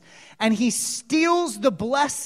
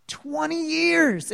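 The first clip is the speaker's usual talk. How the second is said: high, drawn-out exclamation; raised voice, slowly stretched for impact; amazed exclamation; 6 semitones higher, slower than usual. Real, not acted. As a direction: marvel at it, stretching the words high. Exclaimed repeat of the figure.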